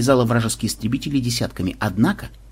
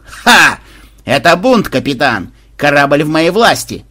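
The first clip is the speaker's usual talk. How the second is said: loud, high proclamation; triumphant mutineer; 3 semitones higher, 8 dB louder, slower than usual. Acted. Mutinous bot bursts in waving a pistol, proclaiming loudly. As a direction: declare the takeover with a loud triumphant ha.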